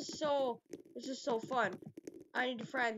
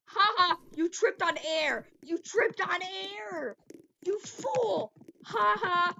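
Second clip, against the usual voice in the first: loud, high mocking crow; gloating taunt; 8 semitones higher, 8 dB louder, slower than usual. Real, not acted. Troll mocking a fallen player, loud high gloating. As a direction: stretch the jeer out, shouting high. Mocks player who tripped.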